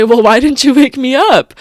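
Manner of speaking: imitating the boyfriend's caring protest; mock exasperated boyfriend. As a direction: playfully scold her for not waking him. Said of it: "He'd say".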